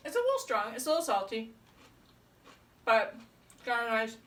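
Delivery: talking through a mouthful of food; chewing while speaking, mouth full. Real, not acted. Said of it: "talking with my mouth full".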